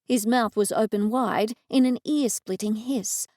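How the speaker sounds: plain reading voice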